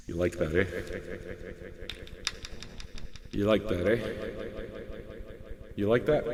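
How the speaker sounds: coaxing murmur. Real, not acted. Coaxing the drink.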